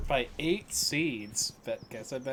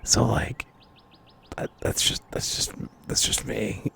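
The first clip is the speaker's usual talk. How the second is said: raspy voice. Real, not acted. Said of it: "a very raspy voice".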